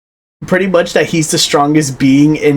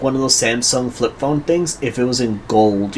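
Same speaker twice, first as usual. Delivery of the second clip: ordinary delivery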